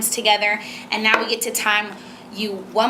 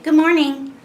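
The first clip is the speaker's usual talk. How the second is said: high, louder copycat greeting; parrot-like echo; 7 semitones higher, 5 dB louder than usual. Shown as a demonstration. Mother demonstrates copying for child, high parroted greeting. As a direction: echo the greeting in a raised, lilting voice. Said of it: "Mimic means kinda like a parrot".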